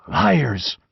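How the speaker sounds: plain reading voice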